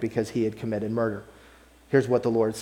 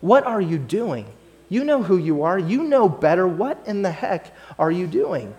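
getting in his face; 6 semitones higher, 4 dB louder than usual. Retold or imitated. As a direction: lean in and scold him hard. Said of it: "gets up in his face a little bit".

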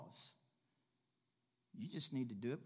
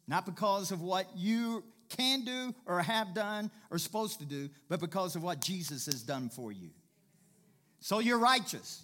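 raised, forceful, deliberate pulpit delivery; full-force preaching; 5 semitones higher, 6 dB louder than usual. Real, not acted. Preacher telling believers what Jesus has done, forceful.

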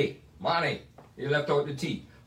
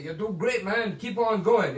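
higher-pitched, upbeat encouragement; encouraging; 5 semitones higher than usual. Retold or imitated. Older musicians to a young player, encouraging. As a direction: cheer on a younger guy. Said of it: "give me a pat on the back".

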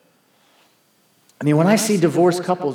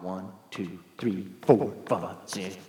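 low, muted count under the breath; grudging step counting; 8 semitones lower, 8 dB quieter, slower than usual. Retold or imitated. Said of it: "he's counting them off".

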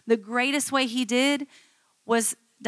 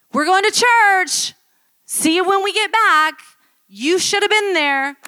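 sing-song holier-than-thou send-off, hammed up; mock churchy announcement; 8 semitones higher, 9 dB louder than usual. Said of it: "to go like".

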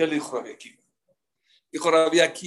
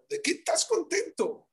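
incredulous question; 13 semitones higher, 3 dB quieter than usual. Acted. Friends question laughing Akiva.

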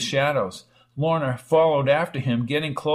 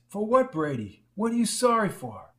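gently pressing; 6 semitones higher than usual. A narrator's reading. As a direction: coax the answer out of him. Lorna presses him.

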